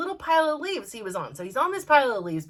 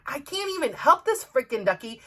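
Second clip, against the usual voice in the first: ordinary talk, nothing marked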